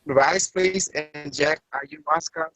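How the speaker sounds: ordinary delivery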